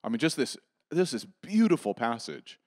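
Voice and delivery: ordinary talk, nothing marked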